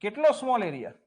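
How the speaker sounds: normal talking voice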